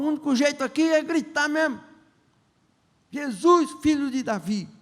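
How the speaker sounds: a blind beggar shouting over the crowd; crying out to Jesus. Acted. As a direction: shout for Jesus to hear you. Blind man decides to shout.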